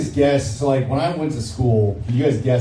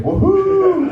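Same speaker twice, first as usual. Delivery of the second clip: cheering whoop; 3 dB louder than usual. Real, not acted. Cheering the crowd after a set.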